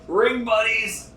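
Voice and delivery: ordinary talk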